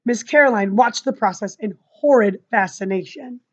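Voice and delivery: usual speaking voice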